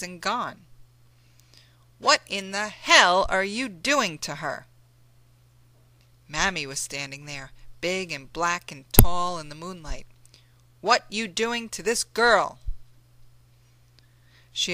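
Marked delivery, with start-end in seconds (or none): 2.0-4.7 loud, furious challenge; Mammy bursting in, furious at John; angry demand. Acted. Mammy confronts John angrily. 10.8-12.6 higher, louder furious question; Mammy shielding the girl, angry; angry demand. Acted. Mammy confronts John.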